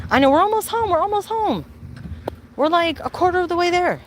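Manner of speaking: high, sing-song reassurance to the dog; pet talk. Real, not acted. Reassuring the hot, tired dog, high pet talk.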